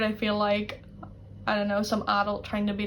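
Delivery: ordinary talk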